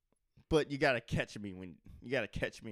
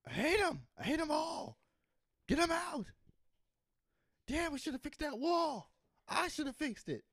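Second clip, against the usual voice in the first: much higher, put-on bigot voice. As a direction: ham up an angry bigot. Parodying a racist rant.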